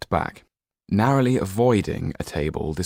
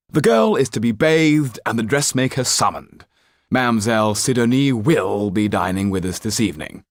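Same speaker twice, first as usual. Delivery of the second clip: higher and louder, a cold aristocratic father; haughty Marquis; 5 semitones higher, 5 dB louder than usual. Acted. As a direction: look down your nose at your daughter. Marquis orders valet.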